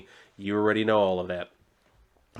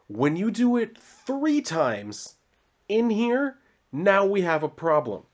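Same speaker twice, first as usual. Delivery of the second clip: mock outrage; 8 semitones higher, slower than usual. Real, not acted. Mock outrage at spelling errors.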